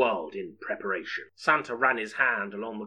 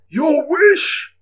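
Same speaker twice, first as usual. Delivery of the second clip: high, loud, frantic shout; alarmed shout; 11 dB louder than usual. Acted. Santa facing Sarah's knife: frantic shout. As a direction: cry it out in panic. Santa startled by armed woman.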